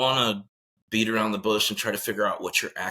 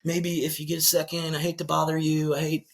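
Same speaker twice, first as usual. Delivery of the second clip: higher-pitched tiptoeing ask; gently mocking over-polite plea; mock hesitant request; 6 semitones higher than usual. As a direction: imitate someone apologetically easing into a favor. Imitates wife's hesitant, tiptoeing request.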